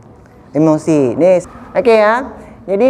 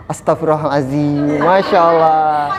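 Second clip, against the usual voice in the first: calm pious murmur; 5 semitones lower, slower than usual. Shown as a demonstration. Teacher shows calm reply to anger.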